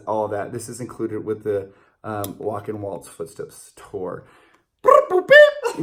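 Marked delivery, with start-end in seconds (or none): 4.8-5.8 high beeping noise; beeping sound. Shown as a demonstration. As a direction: chirp it like a beeper. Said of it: "when I make this sound".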